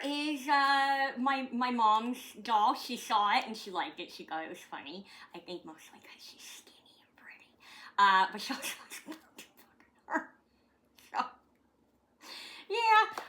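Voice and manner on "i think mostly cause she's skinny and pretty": a breathy whispered aside. Real, not acted. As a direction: drop to a secret whisper for the camera.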